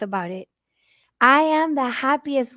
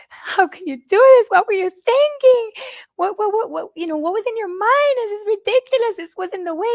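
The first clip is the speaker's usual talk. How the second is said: much higher, loud, breaking anguished plea; anguished crying out; 10 semitones higher, 6 dB louder than usual. Retold or imitated. Mother in the car bawling at God, anguished high cry. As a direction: cry out at God through tears. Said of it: "I'd be, like, bawling".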